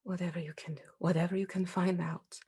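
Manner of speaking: hushed and urgent; whispering. Acted. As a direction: breathe it out, pleading. Ghost urging softly.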